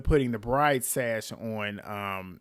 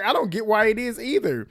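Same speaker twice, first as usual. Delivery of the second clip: agreeing disbelief; 8 semitones higher, 3 dB louder than usual. Retold or imitated. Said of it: "And I'm like".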